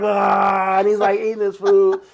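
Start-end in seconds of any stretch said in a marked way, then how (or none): 0.0-0.8 mock yell.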